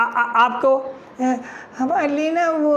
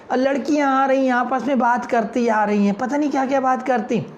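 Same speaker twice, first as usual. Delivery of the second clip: normal talking voice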